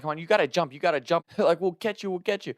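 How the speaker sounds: high coaxing calls; urging jump. Retold or imitated. Friends below coaxing him off the roof, high and urgent.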